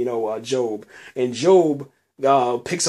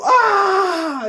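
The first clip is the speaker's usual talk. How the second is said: much higher, louder fan scream; excited scream; 4 dB louder than usual. Retold or imitated. Fan screaming at the TV in joy, high scream. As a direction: shriek like a fan. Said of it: "you're just screaming".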